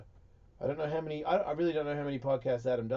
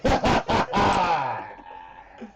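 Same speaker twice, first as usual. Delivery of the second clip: real shouted burst of laughter; loud laugh; 2 semitones higher, 6 dB louder than usual. Real, not acted. Host reacts to co-host's joke, loud laughing. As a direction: crack up loudly.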